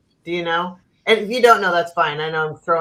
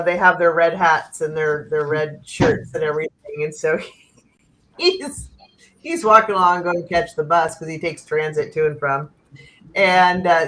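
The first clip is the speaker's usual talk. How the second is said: normal talking voice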